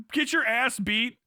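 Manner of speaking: usual speaking voice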